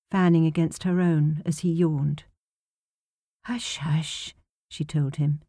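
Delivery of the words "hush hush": soft, quiet hushing; quiet shushing to a drowsy child; soothing hush. Acted. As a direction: settle the little boy gently.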